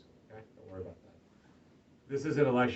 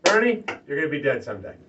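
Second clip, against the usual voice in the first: imitating a pastor's Scottish accent; quick, loud line in a light Scottish accent; a touch of Scottish, brisk and blunt; 2 semitones higher, 8 dB louder, faster than usual. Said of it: "he's got this Scottish accent".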